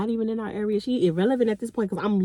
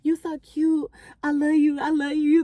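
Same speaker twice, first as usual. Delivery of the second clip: mock seduction; 3 semitones higher than usual. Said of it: "you do, like, a seduction".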